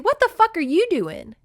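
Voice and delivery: mock shout at the goat; retold yell. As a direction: call back at the goat in disbelief. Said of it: "I said".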